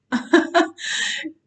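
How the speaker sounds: real burst of high, loud laughter; amused laugh. Real, not acted. Vlogger quotes her doctor on the restless brain, amused laugh. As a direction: laugh out loud at your own remark.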